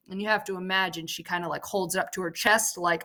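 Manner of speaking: ordinary delivery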